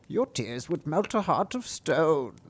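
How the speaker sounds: a slow wet frog speaking up from the well. Acted. Frog in the well speaking to the princess, slow croaky voice.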